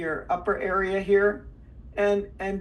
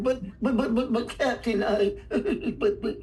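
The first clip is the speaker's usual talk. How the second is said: stammering, cheek-puffed cartoon sidekick; Smee; 2 semitones higher than usual. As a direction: sputter it from inside the cheeks. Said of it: "I used to do Smee".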